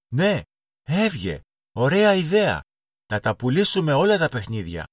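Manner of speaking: put-on delighted children cheering; excited cheer. A narrator's reading. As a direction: jump at the idea with delight.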